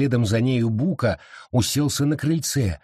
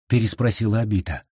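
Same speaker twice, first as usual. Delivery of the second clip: ordinary delivery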